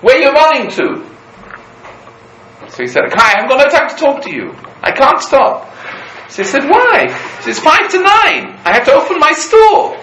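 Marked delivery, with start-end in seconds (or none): 0.0-1.1 high, loud, drawn-out call; a friend hailing Yankel from the other side; calling across the street. Retold or imitated. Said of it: "his friend's called out to him". 3.1-10.0 fast, high, loud rushed reply; a shopkeeper late to open his store; hurried shout back. Retold or imitated. Hurried man calling back.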